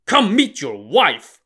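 loud, high, mocking retort; shot back. A narrator's reading. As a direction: snap it back with scorn. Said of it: "Lu Zhishen shot back".

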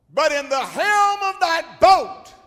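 high, loud preaching rise; preaching climax. Acted. Preacher building to Jesus in the boat, loud. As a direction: lift it up.

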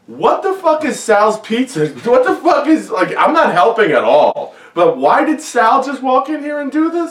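high, laughing disbelief; retold disbelief. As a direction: replay shock at a crazy scene. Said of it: "And I was like".

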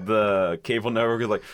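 usual speaking voice

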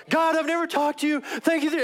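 loud, high outburst of prayer; grandma going off. Retold or imitated. Grandma praying to God for the first time, going off. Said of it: "she just goes off".

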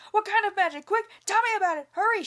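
loud, high and fast demanding; frantic shouting. A narrator's reading. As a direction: shout it, desperate with greed. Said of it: "shouted Li Tong".

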